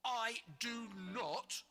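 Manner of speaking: a cheerful booming troll. Acted. Troll explaining to George, booming. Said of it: "boomed the troll".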